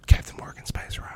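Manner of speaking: breathy whispered wish. Acted. Quipping to his cohost about free rum, whispered. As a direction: whisper it like a secret longing. Wishful aside.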